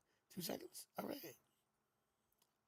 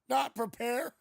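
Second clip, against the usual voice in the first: loud, high teasing punchline; playful dig at Eric; mock jab; 5 semitones higher, 12 dB louder, slower than usual. Streamer teasing his partner Eric with a loud jab.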